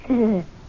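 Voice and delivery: a dry laugh at the quote; amused little laugh; short scornful chuckle. Acted. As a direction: chuckle in disbelief. Laughing at Ashley's words.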